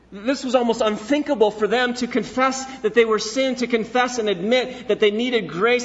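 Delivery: building sermon intensity; fervent preaching. Acted. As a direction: drive it home like a revival preacher. Preacher rises to full force.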